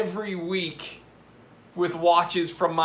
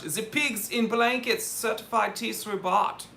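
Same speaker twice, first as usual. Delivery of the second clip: put-on higher-pitched light German accent at normal volume; 5 semitones higher than usual. Livestream host thanking a supporter by name in a light mock-German accent. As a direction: toss it off in a playful German accent. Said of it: "say it in a German accent".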